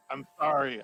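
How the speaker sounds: ordinary delivery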